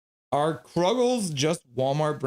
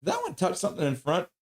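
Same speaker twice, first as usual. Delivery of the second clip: ordinary talk, nothing marked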